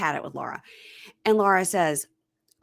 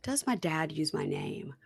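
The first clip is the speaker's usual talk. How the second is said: ordinary delivery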